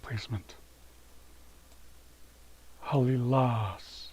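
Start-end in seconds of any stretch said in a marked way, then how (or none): 2.9-4.1 Halilas said slowly, with the weight on the final syllable; stress on last syllable. Shown as a demonstration. Teacher showing viewers the stress, slow Halilas. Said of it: "So the accent goes on the last syllable".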